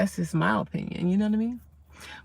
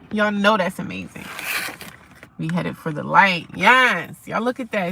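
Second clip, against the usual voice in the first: ordinary delivery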